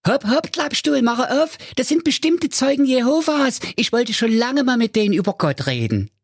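very high, squeaky mother voice; Mother Keule. Acted. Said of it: "Die Mutter sprach".

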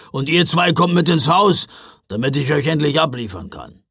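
loud, impatient order; gruff Knecht Ruprecht. A narrator's reading. Driver ordering two children into the house, gruffly. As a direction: order them along gruffly. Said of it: "knurrte Knecht Ruprecht".

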